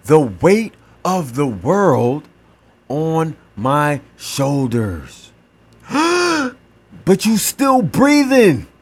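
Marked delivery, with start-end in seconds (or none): 0.1-5.3 slowed, weighed-down. Acted. Heavy weight, slowing down. 5.9-6.5 sharp high gasp for air; demonstrating gasping for breath; acted gasp. Shown as a demonstration. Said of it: "you gasping for air".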